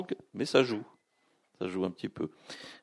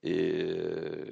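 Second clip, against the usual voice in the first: ordinary talk, nothing marked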